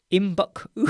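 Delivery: "Imbuk-u" without Ts, tipping into a laugh; silent T. Shown as a demonstration. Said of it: "has a silent T".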